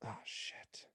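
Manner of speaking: whispered oops under the breath; embarrassed whisper. Real, not acted. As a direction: breathe it out as a slip-up. Slips name, whispers embarrassed.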